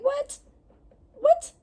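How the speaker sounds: squeaky high disbelieving what what; baffled what. Retold or imitated. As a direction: shoot the voice way up in confusion. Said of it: "I was just like".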